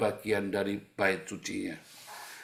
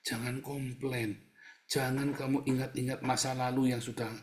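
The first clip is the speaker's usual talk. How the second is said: ordinary talk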